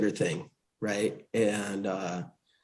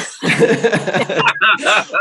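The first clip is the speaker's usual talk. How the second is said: long laugh, pitched high; hearty laugh; 9 semitones higher, 4 dB louder than usual. Real, not acted. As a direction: burst out laughing. Laughing after pidgin joke.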